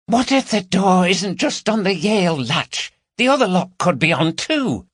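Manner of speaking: high-pitched, worried character voice; anxious burglar. A narrator's reading. Burglar fretting to partner about the lock, anxious. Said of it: "each urging the other to be quiet".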